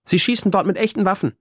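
put-on high, offhand little boy's voice; a small boy saying it like it's nothing; casual young boy. A narrator's reading. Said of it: "als wäre das völlig normal". (hohe, beiläufige Kinderstimme)

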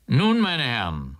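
lightly projected call. A narrator's reading. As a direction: call the gentlemen to attention. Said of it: "rief K".

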